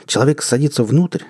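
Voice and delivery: plain reading voice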